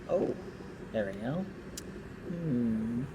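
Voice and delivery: ordinary talk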